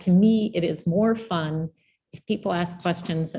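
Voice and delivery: ordinary talk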